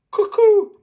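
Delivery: very high, loud bird call; cuckoo call. Acted. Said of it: "Daddy Pig waves his arms".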